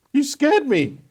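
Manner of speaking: high, loud, frightened cry; shrill-pitched startled protest; startled accusation. Acted. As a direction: blurt it out, shaken by fright. Startled snake blames the woman.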